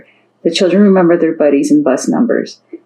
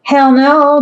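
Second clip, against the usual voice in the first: bellowing; 10 semitones higher than usual. A narrator's reading. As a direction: belt out a flat refusal. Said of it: "bellowed Casey".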